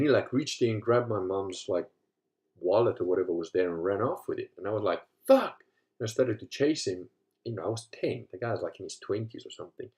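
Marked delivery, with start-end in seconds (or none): 5.3-5.6 sudden high shouted curse; a kid's outraged yell; retold shout. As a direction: blurt it out loud and high as the thief runs. Boy swears as thief escapes.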